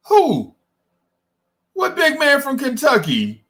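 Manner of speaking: high-pitched disbelieving yelp; incredulous who. Retold or imitated. To Woodshed, shocked by the pick, high and incredulous. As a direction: act stunned at the suggestion.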